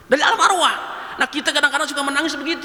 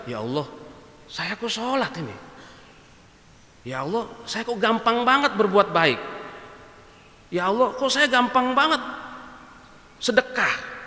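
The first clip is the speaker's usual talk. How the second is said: hushed prayer; 2 semitones lower, 5 dB quieter than usual. Acted. Weeping prayer during prayer.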